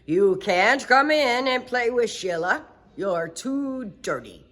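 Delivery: a touch of a gruff father; stern father. Acted. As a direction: send the dirty girl away. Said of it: "He said".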